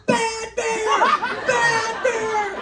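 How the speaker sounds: very high, drawn-out yell; panicked shout. Acted. Hiker scaring off a bear without a thought, shouting. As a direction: shriek it at the top of your lungs. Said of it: "I shouted".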